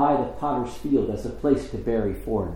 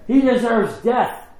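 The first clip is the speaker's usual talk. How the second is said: crowd's verdict; 10 semitones higher, 3 dB louder, slower than usual. Acted. Council condemns Jesus.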